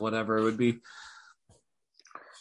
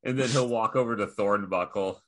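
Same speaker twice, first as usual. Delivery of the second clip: ordinary talk, nothing marked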